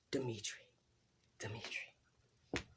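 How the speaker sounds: hushed, slow whisper of a hated name; a soft whisper of distaste; whispered disgust. Real, not acted. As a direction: breathe the name like a curse. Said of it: "dislikes Dimitri".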